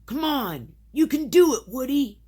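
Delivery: high, loud urging shout; shouted encouragement. A narrator's reading. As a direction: cheer a friend on at the top of your voice. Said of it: "Buzz shouted".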